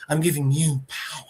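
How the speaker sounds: soft, imitated godly whisper; ominous whisper. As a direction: whisper it as a mysterious gift. Spider god bestows power, hushed.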